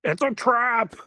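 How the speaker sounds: high, loud hammed-up warning; over-the-top alarmed exclamation; mock alarm cry. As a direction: shout a theatrical warning. Acted famous alarm cry.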